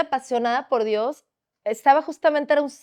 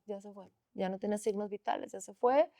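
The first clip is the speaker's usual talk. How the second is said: softly, hiding the news from the mother; grave near-whisper; hushed; 4 semitones lower, 13 dB quieter than usual. Retold or imitated. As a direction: keep it low so the mother can't hear. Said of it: "no queriendo decirlo fuerte".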